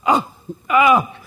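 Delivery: mock delight. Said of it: "you go".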